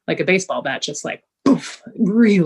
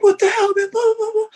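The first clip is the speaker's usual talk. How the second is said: much higher, loud outraged yell; angry shout; 5 dB louder than usual. Retold or imitated. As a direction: yell up at him in shock and fury. Reacting to lumber hitting head.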